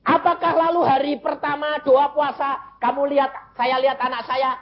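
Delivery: high, sustained preacher's pitch; impassioned preaching. Real, not acted. Preacher telling congregation of the first day of fasting, impassioned. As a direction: proclaim it high, driving the story on.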